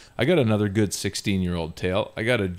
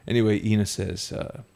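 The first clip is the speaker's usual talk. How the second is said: usual speaking voice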